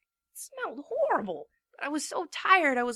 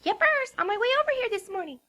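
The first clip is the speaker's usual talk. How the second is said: high, fast, bouncy pony voice; sugary high-pitched cheer; bubbly Pinkie; 8 semitones higher, faster than usual. A narrator's reading. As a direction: chirp it out like an excited filly.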